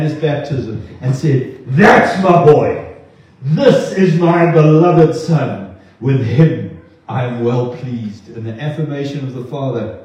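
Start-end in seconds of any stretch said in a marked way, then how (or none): 1.7-8.3 loud, slow fatherly acclaim; proud proclamation. Acted. God tearing open heaven at his Son's baptism, proudly proclaiming. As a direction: proclaim it slowly with booming pride, easing at the end.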